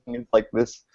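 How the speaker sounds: ordinary talk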